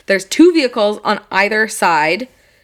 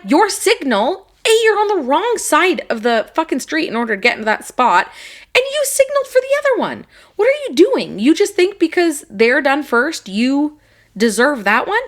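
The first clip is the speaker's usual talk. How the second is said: higher, heated mock telling-off of the driver; retold angry rant; 6 semitones higher than usual. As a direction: replay the parking-lot rant, fired up and pitched high. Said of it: "And I'm like".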